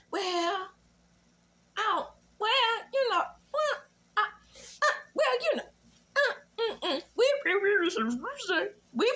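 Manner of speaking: high, hesitant, stalling stammer; evasive stammer. Retold or imitated. Imitating the wife dodging the question with a stammer. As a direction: stall and hem, trying not to answer.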